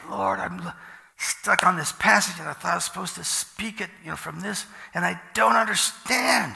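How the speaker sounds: rising to a loud, high cry; emphatic plea. Retold or imitated. The young minister crying out to the Lord, emphatic. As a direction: plead harder until it breaks out. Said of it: "And I was pretty emphatic".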